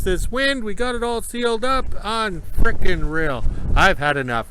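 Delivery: shouting over wind. Real, not acted. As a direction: call out over the gusts. Outdoors in strong wind.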